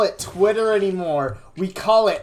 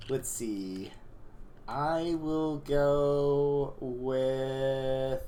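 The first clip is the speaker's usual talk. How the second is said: hushed; 6 semitones lower, 9 dB quieter, slower than usual. Acted. Hushed card-drawing ritual.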